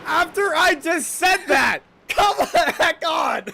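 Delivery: loud, high, drawn-out protest; indignant outburst breaking into a laugh; exasperated shout. Real, not acted. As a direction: yell it in comic disbelief. Exasperated at crash after his remark.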